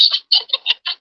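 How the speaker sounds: high witchy cackle; real high cackle; cackling laugh. Real, not acted. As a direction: cackle at the accusation. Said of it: "cackling for over there, Broomhilda".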